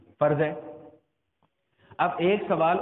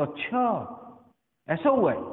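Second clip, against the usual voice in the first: surprised; 3 dB quieter than usual. Shown as a demonstration. Preacher shows listeners how one word changes with amazement, surprised. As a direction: say اچھا as amazed disbelief, pitch climbing. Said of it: "حیرانگی ہو تو ہمارا انداز مختلف".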